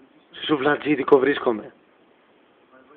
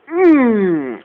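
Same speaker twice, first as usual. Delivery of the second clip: whimper; 6 semitones higher, 5 dB louder than usual. Acted. Kid scared of being locked up.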